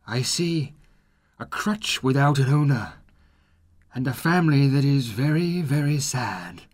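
high, solemn ghost voice with mild sorrow; sad spirit. Acted. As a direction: deliver the prophecy gently. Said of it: "replies the spirit sadly".

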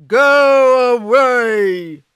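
very loud, slow ogre shout; angry ogre. Acted. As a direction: roar it like a cross ogre.

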